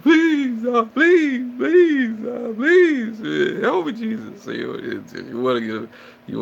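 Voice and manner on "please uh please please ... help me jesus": very high, loud hammed-up begging; desperate pleading. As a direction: beg Jesus in mock terror, far higher.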